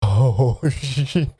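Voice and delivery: usual speaking voice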